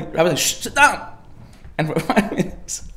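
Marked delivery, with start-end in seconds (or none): 0.4-1.1 sharp high shush and order; mock stern hushing. Rabbi to squirming man, mock stern. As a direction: hush him and order him down.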